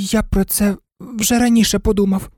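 high, hesitant little dog voice; timid. A narrator's reading. Toy dog timidly offering his idea to the others, high voice. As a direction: venture it shyly. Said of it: "боязко промовив курдуплик".

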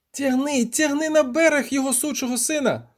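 very high, sustained cry; wildly excited, high-pitched order; frantic urging. A narrator's reading. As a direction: cry out as the catch fights back. Poet urging as fish pulls away.

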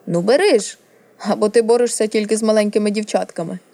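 goading taunt. Acted. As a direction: goad a scared opponent into fighting. Prince goads the frightened boy.

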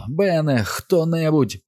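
high-pitched call for help; desperate holler. A narrator's reading. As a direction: call out to anyone who might hear. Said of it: "знову загорлав Біл".

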